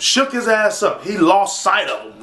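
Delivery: ordinary delivery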